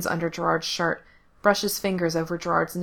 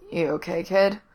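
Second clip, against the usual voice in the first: sleepy. A narrator's reading. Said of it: "voice thick with sleep".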